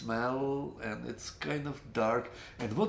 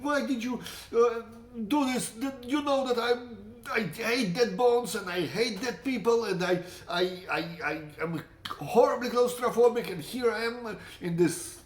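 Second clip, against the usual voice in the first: higher, mock furious cursing at the friend; retold cursing at his friend Yakov, voiced higher; cursing in anger; 7 semitones higher than usual. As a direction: vent at him, retelling the rage. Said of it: "I'm cursing him like".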